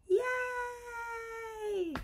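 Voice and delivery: a high, sung-out yay; high cheer for her team; cheerful yay. Real, not acted. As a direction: cheer them on brightly. Cheering the practitioners on video.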